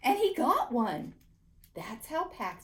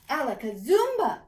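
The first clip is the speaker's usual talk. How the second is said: loud magic incantation; 4 semitones higher, 8 dB louder than usual. A narrator's reading. Boy casting his magic word, loud and proud. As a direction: shout the magic word with a flourish.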